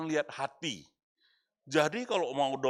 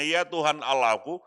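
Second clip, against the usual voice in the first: crying out to God; 5 semitones higher, 11 dB louder than usual. Acted. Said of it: "dia berseru kepada Tuhan".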